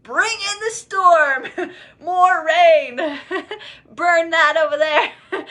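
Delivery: hammed-up deity ordering chaos; playful God voice. God cheerfully ordering storms on the speaker, high and laughing. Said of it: "And God's like".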